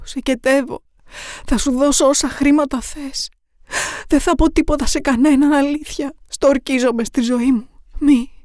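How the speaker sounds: begging for her life, voice climbing; terrified cries for mercy; desperate pleading. Acted. Captive begs her killer.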